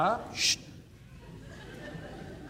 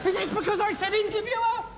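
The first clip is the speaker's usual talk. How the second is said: indignant; 9 semitones higher, faster than usual. Acted. High indignant reaction.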